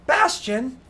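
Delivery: high, loud call of a name. Acted. Woman yells for Bastian to come out. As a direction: yell for Bastian down the hall. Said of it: "and she's like".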